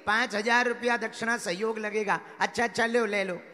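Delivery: loud, projected parable telling; rousing preacher storytelling, punching phrase ends; full-force preaching. Acted. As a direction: drive the story out to a big congregation.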